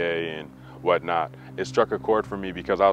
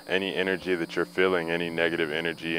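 guided meditation. Acted. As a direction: speak it like a healing blessing. Spoken affirmation to the viewers.

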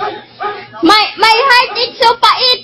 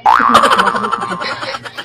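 laugh. Real, not acted. Laughing at the fake quarrel.

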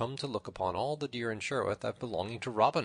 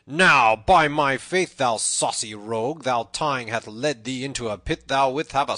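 loud, raised angry threatening; in a rage; 5 semitones higher, 11 dB louder than usual. A narrator's reading. Little John challenges the tanner to fight, raging. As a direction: threaten him with a drubbing. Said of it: "cried Little John in a rage".

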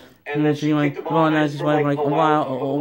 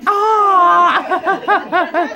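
mock startled yelp; 11 semitones higher, 7 dB louder than usual. Spider-Man reacts to his phone.

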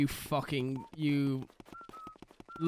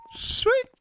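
put-on much higher, sharp old man's "Say!"; Herbert's call. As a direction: call out like a creepy old neighbor. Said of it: "gap in my teeth".

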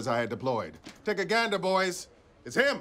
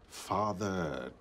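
gloomy; 10 semitones lower, 7 dB quieter, much slower than usual. Acted. Gloomy dramatic pause.

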